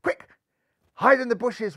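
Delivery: plain reading voice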